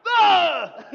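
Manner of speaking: loud, high mummy groan; dusty mummy rasp. Pretend. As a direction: let out a loud mummy moan. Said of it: "You wanna sound like a mummy".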